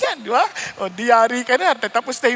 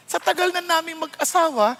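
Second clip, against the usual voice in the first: ordinary talk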